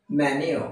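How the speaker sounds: "মেনু" said as "MEN-yo", y glide; correct pronunciation. Shown as a demonstration. Teacher giving learners the proper form, "মেনু" as MEN-yu. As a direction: say "মেনু" with "nyu" at the end. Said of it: "এইটা হচ্ছে".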